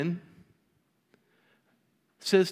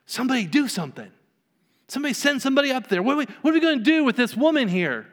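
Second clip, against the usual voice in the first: high, fast flustered fuss; hammed-up church panic; 7 semitones higher, faster than usual. People at the back fretting about the woman, high fast panic. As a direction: rattle it off like a panicking church lady. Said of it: "some people in the back are going".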